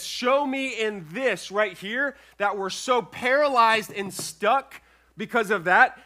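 loud, high-pitched, driving sermon delivery; full-voiced, emphatic preacher; forceful preaching. Real, not acted. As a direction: preach it hard and urgently to the congregation.

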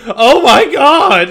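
high, loud, drawn-out mock-horrified cry; disgusted, shocked horror. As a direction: imitate Thor crying out, appalled and revolted. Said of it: "disgusted, shocked, horrified all at once".

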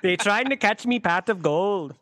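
drawn-out cartoon Irish; mock Irish accent. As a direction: ham up a jokey Irish accent. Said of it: "make a joke in an Irish accent".

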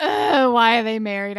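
loud, exasperated outburst; exasperated groan. Real, not acted. Podcaster fed up with a TV couple, loudly exasperated. Exasperated rant about a fictional marriage.

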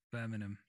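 BIRMINGHAM as "BUR-min-um"; own pronunciation. Shown as a demonstration. Guest demonstrating the disputed city name, own pronunciation. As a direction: drop the G from "Birmingham". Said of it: "That's how I say it".

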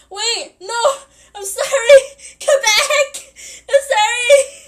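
high, loud, laughing plea to come back; laughing apology. Retold or imitated. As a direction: call after them, laughing and sorry. Begging frenemy to come back.

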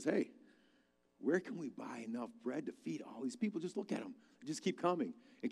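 soft, private question to Philip; hushed aside. Retold or imitated. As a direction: drop the voice as if leaning over to a friend. Jesus leans over to Philip.

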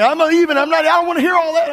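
high, loud fed-up husband; exasperated husband. Retold or imitated. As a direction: storm off mid-argument. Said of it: "you men, you're like".

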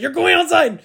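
high, loud, laughing mock yell; mock yell at dog. Owner fed up with the dog, mock yells through a laugh. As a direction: bark the order through a laugh. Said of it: "and I was like".

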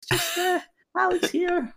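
very high-pitched squeak of a younger self; tiny high squeaky video intro; little squeaky voice. Retold or imitated. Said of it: "with my little squeaky voice".

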